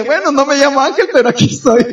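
punchline tipping into laughter; amused retold reply, about to crack up; remate divertido. Remate cómico de su anécdota. (remate a punto de reír)

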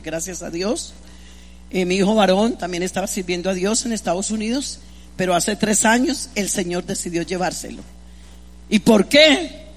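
normal talking voice

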